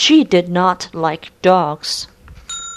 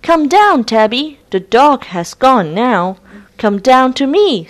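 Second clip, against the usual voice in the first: higher, louder calling up the tree; coaxing a cat; 6 semitones higher, 4 dB louder than usual. Acted. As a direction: coax the cat down. Kate coaxes cat from tree.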